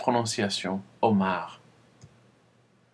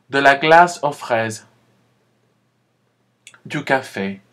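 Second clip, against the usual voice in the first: louder, spaced teacher model; model repetition; 5 dB louder than usual. Shown as a demonstration. Teacher listing desserts for the class to repeat. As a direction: pause after each dessert for echo. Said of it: "Répétez".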